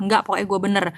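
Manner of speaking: ordinary talk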